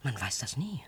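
hushed, breathless whisper. Acted. Whispering doubt to a companion who hopes they're cared for. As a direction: say it under your breath, unsure.

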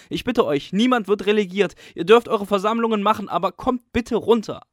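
loud, high, begging and out of breath; breathless pleading. A narrator's reading. Caretaker giving in to the pupils, breathless loud plea. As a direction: beg them down, panting. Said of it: "sagt er schwer atmend".

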